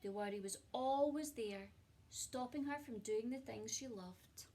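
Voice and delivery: ordinary delivery, nothing marked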